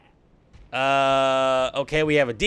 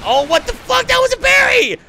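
high, loud yell of disbelief; outraged disbelief; 5 dB louder than usual. Real, not acted. Gamer yells at the game when his parry fails, high and loud. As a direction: yell in shock that the parry failed.

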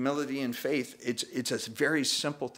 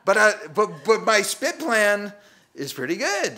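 higher, louder stammering plea; higher, louder flustered plea to God; pleading protest; 9 semitones higher, 9 dB louder than usual. Retold or imitated. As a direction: beg God to keep my plan. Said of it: "and I go".

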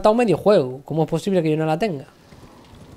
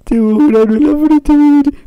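loud bawling far above usual pitch; high mock-crying wail; 9 semitones higher, 10 dB louder than usual. Streamer repeating the pimple complaint, high loud wail. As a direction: push the whine up into a wail.

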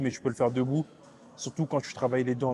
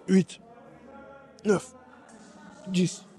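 higher count forced out under load; straining reps; 5 semitones higher, much slower than usual. Real, not acted. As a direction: push through the burn. Straining through last reps.

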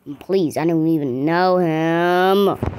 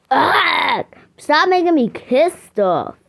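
whiny protest; 6 semitones higher, 4 dB louder than usual. Pretend. Made to kiss again.

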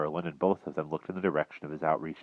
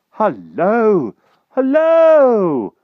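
high, loud drawn-out halloo; crying out; 14 dB louder, much slower than usual. A narrator's reading. Knight king hails new arrivals, crying out. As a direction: call out at full voice, pitched way up. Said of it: "cried King Pellinore".

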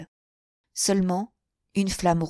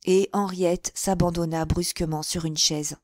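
ordinary delivery

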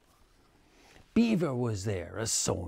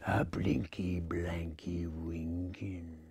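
low, slow whispered dread; creepy low whisper; ominous whisper; 7 semitones lower, 9 dB quieter, slower than usual. A narrator's reading. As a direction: hush it like danger is near. Ominous arrival of the gator.

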